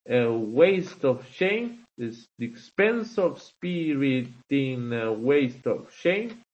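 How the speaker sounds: "a waste of shame" read very slowly, stress falling on "waste" and "shame"; slow iambic reading. Shown as a demonstration. Teacher to class, scanning the Shakespeare line slowly to show the iambs. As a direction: beat out the iambs, unstressed then stressed. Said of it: "esto es lo que se conoce como un jambo".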